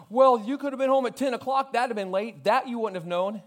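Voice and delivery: high, loud, furious retold rant; angry wife yelling. Husband home at five, wife yelling at him angrily. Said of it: "She's screaming".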